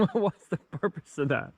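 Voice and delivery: ordinary talk, nothing marked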